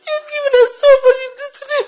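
high, crying prayer; real sobbing supplication, voice pushed high; tearful pleading. Real, not acted. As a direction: weep as you beg God for forgiveness. Weeping supplication for the suffering.

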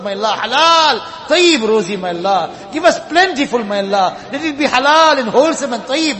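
normal talking voice

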